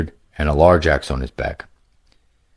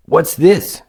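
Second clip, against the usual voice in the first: put-on lightly raised, eager young gamer voice; a casual young player, a bit higher than the narration; upbeat young adventurer, higher pitched; young hero Greylick; 11 semitones higher, 4 dB louder than usual.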